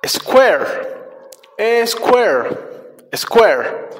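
"Square" said singly, with long pauses; repeat-after-me model. Shown as a demonstration. Teacher leading children in practice, clear spaced-out "Square". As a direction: model "Square" and leave room to echo. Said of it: "Repitan después de mí".

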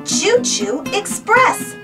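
ordinary delivery, nothing marked

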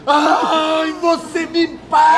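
loud, high, drawn-out vengeful yell; big scream. Acted. Director recalling the big scream of revenge, screamed. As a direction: scream the threat out long and high. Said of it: "Lembra aquele gritão?".

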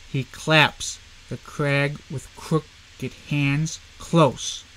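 slow measured recital with each beat marked; half-speed reading of the whole poem. Shown as a demonstration. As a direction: read it unhurried, leaning on the beats. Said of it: "gonna read this one more time".

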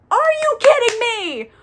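hammed-up furious shout, squeaking with indignation; God. God scolds the fallen angel, mock-furious shouting. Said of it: "So God's upset with him".

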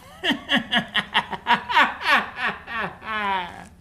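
long cackling mad-scientist laugh; evil scientist laugh. Pretend. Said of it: "What's your best evil scientist laugh?".

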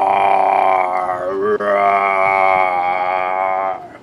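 lightly voiced dinosaur roar; a hint of a dinosaur roaring. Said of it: "very terrible, terrible dinosaur impression".

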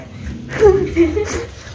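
shrill burst of laughter; high squealing laugh. Real, not acted. Watching the dog run in circles, he squeals laughing.

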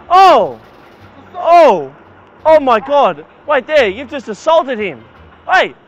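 real alarm bursting out; sudden loud shocked cries; shocked shouts. Real, not acted. Shocked reaction to assault.

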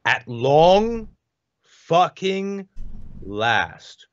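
"at long fucking last" word by word with pauses; emphatic pauses. Acted. Emphatic pauses between words while reading.

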